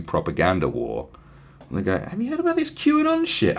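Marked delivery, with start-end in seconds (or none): 2.0-3.6 much higher, gossiping jihadi voice; pitched-up, chatty, comic voice; mock ISIS guy. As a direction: play a jihadi gossiping to a mate.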